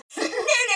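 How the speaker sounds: high, sharp laugh; burst of laughter. Acted. Droid asked about fun, bursting out laughing. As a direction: laugh out at the question.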